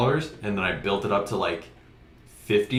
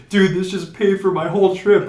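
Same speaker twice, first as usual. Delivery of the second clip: higher and louder, thrilled; excited friend; 11 semitones higher, 8 dB louder than usual. Retold or imitated. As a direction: crow about the big win. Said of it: "He's like".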